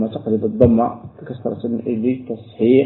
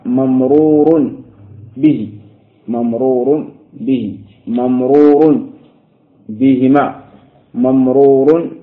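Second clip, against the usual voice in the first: ممرور said slowly and louder, stressed each time; slow emphatic drill; 6 dB louder, slower than usual. Shown as a demonstration. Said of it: "تقول ماذا؟". (المعلم يردد ممرور به بتأن ووضوح للطلاب، بنبرة مشددة)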